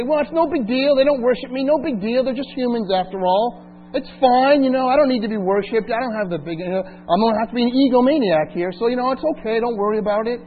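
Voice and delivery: hammed-up nonchalant God; mock shrug. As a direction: pretend it's no big deal at all. Imagined God shrugging off neglect.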